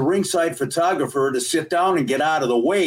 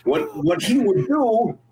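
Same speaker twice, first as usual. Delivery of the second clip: Little Ricky impression; 6 semitones higher than usual. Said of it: "He would talk like this".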